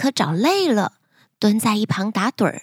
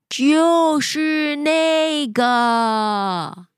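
super slow sleepy sloth; 2 semitones higher, much slower than usual. Acted. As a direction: stretch every word like a sleepy sloth. Sloth character speaks.

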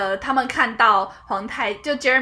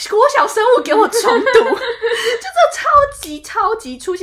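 high, loud, laughing rebuke, then slow; laughing mock scolding; 11 semitones higher, 8 dB louder, slower than usual. Real, not acted. Mocking the drama's art designer to her co-host, laughing. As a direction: scold the set designer while cracking up.

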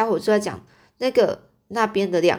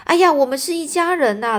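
high, loud burst of welcome; loud joyful cry; 8 semitones higher, 5 dB louder than usual. Acted. The miner greeting a fellow member, loud and high. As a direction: throw it out loud with sudden delight. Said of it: "他突然就扬眉大声说".

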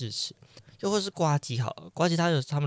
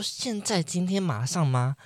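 surprised; 6 semitones higher than usual. Retold or imitated. Startled by dentist's offer.

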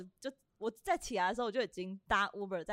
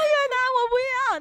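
high, loud crying yell; half-crying drunk shout; drunken wailing shout; 9 dB louder than usual. Retold or imitated. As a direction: wail the refusal like a sloppy drunk. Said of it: "一直在舞池里面大喊".

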